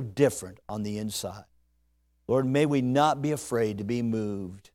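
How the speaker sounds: low, soft, earnest prayer voice. Real, not acted. Preacher praying over the congregation before the sermon. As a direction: pray quietly and sincerely.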